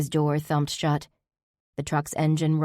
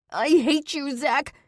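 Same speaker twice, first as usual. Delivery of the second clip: screamed hatred; 11 semitones higher, slower than usual. A narrator's reading. Held by Zach, screaming hate at him. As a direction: hurl it at him.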